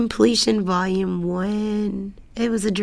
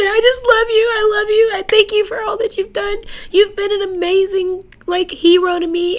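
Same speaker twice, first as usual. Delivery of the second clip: higher, louder, tearful outpouring; tearful gush; 12 semitones higher, 4 dB louder than usual. Retold or imitated. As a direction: pour out your love near tears. Emotional words to her hero.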